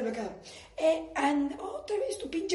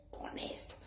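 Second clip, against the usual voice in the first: whisper; 14 dB quieter than usual. Real, not acted. Whispered aside.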